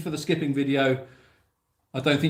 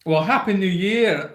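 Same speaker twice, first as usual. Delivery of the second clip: put-on a generic Scottish accent, pitched higher; 5 semitones higher, 4 dB louder than usual. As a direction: wish a Happy New Year in Scottish. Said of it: "I'll give it to you just in Scottish".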